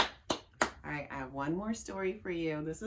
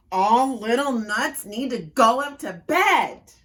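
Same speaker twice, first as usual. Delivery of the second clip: much louder, higher booming mama; mama at her loudest, ordering bed; biggest mama voice; 5 semitones higher, 11 dB louder than usual. Acted. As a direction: bellow it up the stairs. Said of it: "in her biggest voice".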